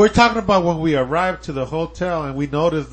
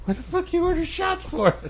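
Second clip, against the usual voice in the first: much higher, softer voice; retold disbelieving question; 11 semitones higher, 7 dB quieter than usual. Retelling asking his friend about the drinks, high mock disbelief. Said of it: "I was like".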